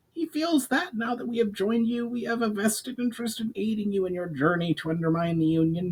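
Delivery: goblin voice. A narrator's reading. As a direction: pitch it up like a goblin emissary offering help. Goblin explains orders.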